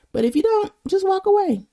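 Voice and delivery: high mock dismissal. Shooing away unhappy podcast listeners, high playful sing-song. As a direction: toss it off lightly, way up high.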